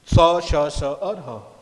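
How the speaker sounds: स, श, स और ह pushed out loudly, one by one; breathy sibilant demo. Shown as a demonstration. Teacher drilling the class, forceful स, श, स और ह. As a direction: punch each of स, श, स और ह with a push of air. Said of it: "इनके उच्चारण के समय आपको लगेगा कि एक गर्म हवा".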